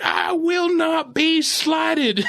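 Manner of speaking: high, slow, shouted declaration; drawn-out mock-indignant shout; mock grand speech. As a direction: proclaim it like a wounded orator, hammed up. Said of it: "like he's doing a speech".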